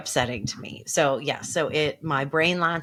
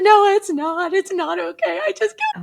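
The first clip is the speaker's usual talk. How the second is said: high-pitched wailing protest, hammed up; distraught wail; 6 dB louder than usual. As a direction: wail it in mock despair, very high. Acted distraught reply to dispatcher.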